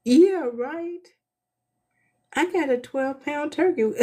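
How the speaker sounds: high, loud scoffing "yeah right"; incredulous retort to God in a dream; sarcastic disbelief. Retold or imitated. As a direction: brush off the idea in mock disbelief. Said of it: "And I was like".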